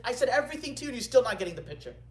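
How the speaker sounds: fast, high, loud scolding; exasperated. Retold or imitated. Scholar scolds the caliph for missing the point, exasperated. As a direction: rush it out in frustration.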